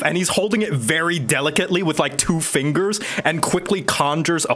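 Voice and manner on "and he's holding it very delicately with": ordinary delivery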